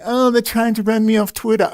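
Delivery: high, whiny mock complaint; mocking Megyn Kelly. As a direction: parody a pampered celebrity whining. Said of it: "it isn't Megyn Kelly".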